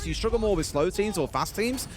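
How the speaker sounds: ordinary talk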